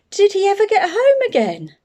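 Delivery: put-on a small girl amazed by the story, with a laugh; little girl voice. Jenny asks the bear.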